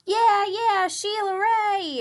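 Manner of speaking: high, loud cheer from the friends; cheering yell. Acted. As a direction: cheer her on like a crowd of kids. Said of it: "her friends yelled".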